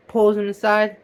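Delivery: usual speaking voice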